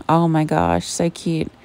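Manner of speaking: ordinary talk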